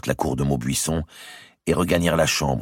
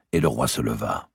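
plain reading voice

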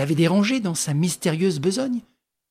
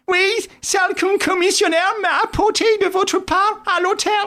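much higher, louder timid voice; an anxious sidekick explaining; Wilson's voice; 5 dB louder than usual. A narrator's reading. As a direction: play the flustered Wilson.

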